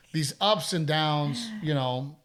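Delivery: ordinary talk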